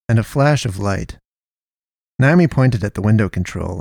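ordinary delivery

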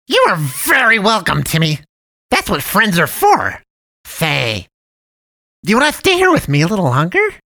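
sugary high cartoon voice; jolly cat man. A narrator's reading. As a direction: play the friendly dump cat, overly sweet. Cat man invites boy to stay.

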